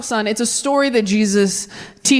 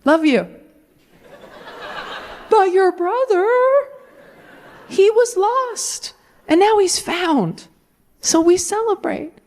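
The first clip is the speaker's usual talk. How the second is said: sappy dad; 8 semitones higher than usual. Retold or imitated. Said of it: "And the dad is like".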